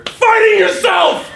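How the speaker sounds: high, loud, slow shout; teacher shouting to the class. Real, not acted. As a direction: drive it home at full volume.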